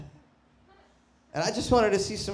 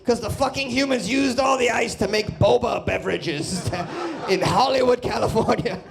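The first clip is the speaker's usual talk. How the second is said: mock rant; 6 semitones higher than usual. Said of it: "I'm like".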